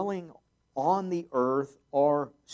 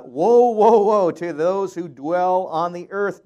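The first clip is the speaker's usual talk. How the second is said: loud dramatic cry; 5 semitones higher, 13 dB louder, slower than usual. Acted. Dramatic read of eagle's cry.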